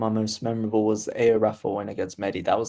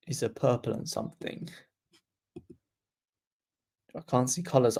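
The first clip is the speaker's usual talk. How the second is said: normal talking voice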